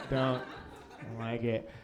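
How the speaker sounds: low, quiet grumble; understated low-voiced cop objection; mock disapproving cop. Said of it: "I look like a cop".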